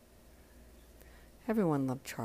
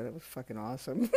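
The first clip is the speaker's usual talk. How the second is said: tearful, softer words; crying; 3 semitones lower, 7 dB quieter, faster than usual. Real, not acted. Grieving host remembering Charlie's answer, crying. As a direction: praise through real tears. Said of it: "I'm exhausted from crying".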